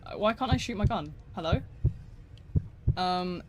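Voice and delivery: ordinary delivery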